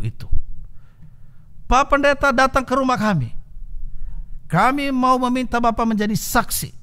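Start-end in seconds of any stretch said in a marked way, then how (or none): 1.7-6.7 wife's invitation. Retold or imitated. Wife inviting pastor, voiced high.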